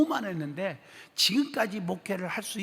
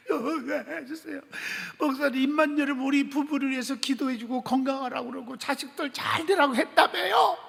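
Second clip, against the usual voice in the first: rising into higher crying plea; tearful confession; 4 semitones higher than usual. Retold or imitated. Deacon begging the pastor's forgiveness, crying. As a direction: beg forgiveness through sobs.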